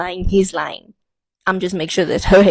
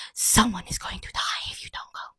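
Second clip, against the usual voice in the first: whispered threat; 9 dB quieter than usual. Retold or imitated. Telling her mother to leave, in a tense whisper. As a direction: breathe the warning almost soundlessly. Warning mother during confrontation.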